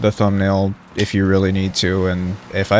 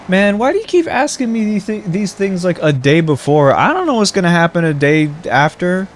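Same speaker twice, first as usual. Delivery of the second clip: exasperated whine; 8 semitones higher than usual. Real, not acted. Exasperated complaint to friend.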